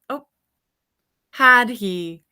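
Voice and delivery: usual speaking voice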